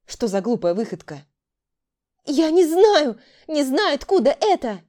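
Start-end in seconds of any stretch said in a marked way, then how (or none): 2.2-4.8 high, loud protest; exclaiming. A narrator's reading. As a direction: cry out in denial. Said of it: "воскликнула девушка". (громко воскликнуть)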